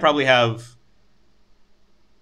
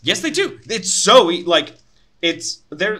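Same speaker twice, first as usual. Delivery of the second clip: high-pitched, louder outburst of disagreement; indignant protest; 8 semitones higher, 7 dB louder, slower than usual. Real, not acted. Contradicting the co-host who excuses strangers, indignant. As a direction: object hotly, voice leaping right up. Protesting against the co-host's objection.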